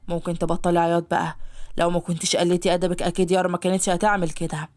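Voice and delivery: low, impatient male voice; impatient master. Acted. Ashhab tells the crying maid to stop, low and impatient. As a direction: tell her to stop crying, annoyed.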